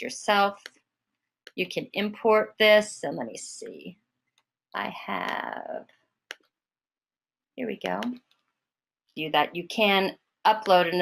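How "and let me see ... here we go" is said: thinking aloud under one's voice; muttering to oneself. Real, not acted. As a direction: trail into a murmur while searching. Searching on screen mid-demonstration.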